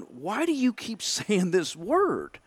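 slow, high, puzzled and half laughing; stunned disbelief through a chuckle; baffled question. Retold or imitated. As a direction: ask it bewildered, a laugh slipping in. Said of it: "I'm going".

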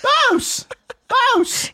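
very high, loud repeated holler; hammed-up one-word yell; random guy calling out. Repeats the shouting guy's call.

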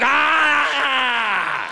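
long, loud high yell; berserk battle scream. Acted. Comic as Canadian handed a hockey stick, mad war cry. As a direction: scream like you've lost your mind.

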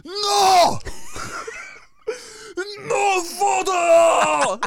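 furious high yell, laughing through it; mock-furious screaming at a tourist; angry shout. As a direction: scream it like an angry old man. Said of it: "he goes".

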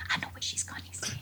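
breathy retold whisper; Billy whispering a guess to [name]. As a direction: whisper it like a secret aside. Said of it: "He was whispering to me".

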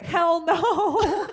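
mock blunt hell no through a laugh; laughing retold refusal. Husband refusing to read to baby, laughing. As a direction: toss it off laughing.

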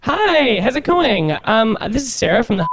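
put-on college girl voice. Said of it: "try to talk like a college girl".

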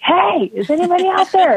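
mild yell, pitched well up; little yell. Retold or imitated. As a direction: call out to the next room. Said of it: "yell a little loud".

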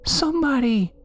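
put-on very high, plaintive child voice; tiny high-pitched whimper; whimpering little girl. A narrator's reading. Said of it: "it whimpered".

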